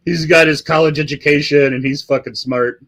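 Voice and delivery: usual speaking voice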